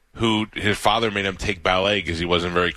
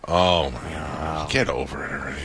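dismissive; 6 semitones lower than usual. Pretend. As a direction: brush it off coldly, tongue in cheek. Mock dismissive reply to crying.

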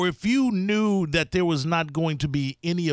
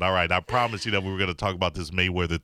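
brisk radio host voice; radio host read; 10 semitones lower, faster than usual. Acted. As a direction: read the call-in line like an announcer.

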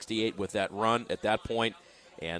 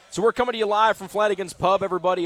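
loud hype; 9 semitones higher, 7 dB louder, faster than usual. Real, not acted. Hyping live crowd.

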